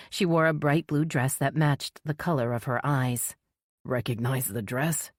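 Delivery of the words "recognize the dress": low, quiet and grim. A narrator's reading. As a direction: say it heavy and grim. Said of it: "His voice was grim".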